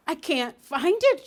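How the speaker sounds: a sudden high, loud fret; anxiously, searching and not finding it. A narrator's reading. Duchess fumbling for the missing patty pan, anxious. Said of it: "she said anxiously".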